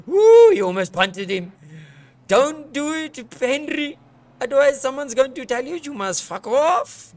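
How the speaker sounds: loud excited commentary; excited outburst. Real, not acted. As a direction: urge the driver on. Cheering and warning driver.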